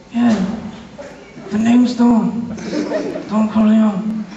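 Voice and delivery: mumbled mafia boss, long pauses; barely articulate, like Brando's godfather; Don Corleone. Acted. Don giving his name at the interview, mumbling godfather voice. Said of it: "if you don't understand what he says".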